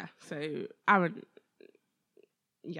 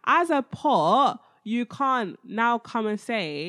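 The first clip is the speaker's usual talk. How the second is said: loud, scolding voice of God, pitched up; God's exasperated rebuke; 3 semitones higher, 7 dB louder, much slower than usual. Retold or imitated. God scolds the clay pot, loud and exasperated. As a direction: berate the atheist like a fed-up parent. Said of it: "He's saying".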